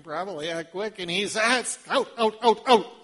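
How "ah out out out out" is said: loud, firm commands; ordering out. Acted. Jesus ordering laughing mourners out, louder and firm. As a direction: push them out the door.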